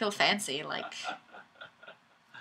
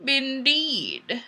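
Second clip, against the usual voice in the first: ordinary delivery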